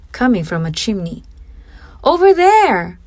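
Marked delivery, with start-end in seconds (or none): 2.0-3.0 high, loud excited shout; a boy shouting with relief at seeing smoke; excited exclaim. A narrator's reading. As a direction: call out, spotting the chimney smoke. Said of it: "Hansel exclaimed".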